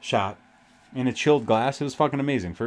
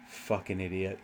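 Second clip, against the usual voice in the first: low, much softer aside in imitation; retold muttered insult; 7 semitones lower, 13 dB quieter than usual. Retelling a friend's remark on the knife request, low muttered insult. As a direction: quote it under your breath, pitched well down.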